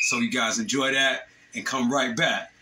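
normal talking voice

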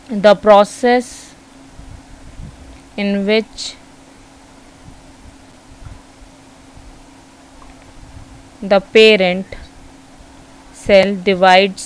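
drawn-out words with long pauses; slow note-taking pace; dictating a definition. Real, not acted. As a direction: dictate slowly, a phrase at a time.

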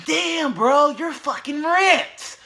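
put-on high-pitched kid voice. Kid asking the ripped guy for advice in a high voice. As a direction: pitch it up like an eager young kid. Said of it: "He asked me".

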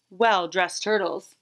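usual speaking voice

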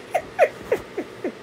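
high airless laugh falling; quick silent laugh; breathy giggle. Real, not acted. Laughing at uncle's quote.

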